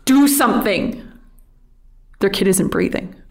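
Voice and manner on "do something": higher, mild urgent yell; acted yell without full volume; retold yell. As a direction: imitate a frantic parent's plea. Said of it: "they yell at me".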